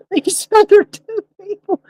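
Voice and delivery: high, laughing; words squeezed out between laughs; laughing through the words. Real, not acted. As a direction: still cracking up.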